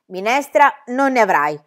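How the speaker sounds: curt. A narrator's reading. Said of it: "disse recisamente".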